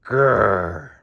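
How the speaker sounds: short mock bear growl; a light, grouchy little grrr; playful growl. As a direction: growl like a grumpy cartoon bear. Grumpy Bear introduces himself.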